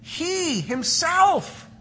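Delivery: "He himself" drawn out slow and high; emphatic himself. Shown as a demonstration. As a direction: lean hard on "himself". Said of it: "the phrase is emphatic".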